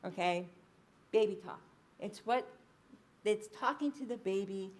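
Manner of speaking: usual speaking voice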